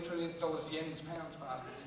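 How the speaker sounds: usual speaking voice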